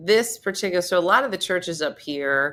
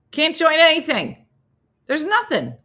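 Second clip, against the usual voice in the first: much higher exasperated cry; dismay; 10 semitones higher than usual. Real, not acted. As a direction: wail it in disbelief. Dismay at empty bulletin.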